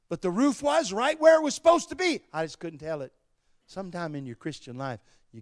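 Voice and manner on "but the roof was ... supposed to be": high, loud preaching outburst; emphatic shout. Real, not acted. Preacher insisting on the fact in a vertigo story, shouting. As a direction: punch it out at full voice, pitched way up.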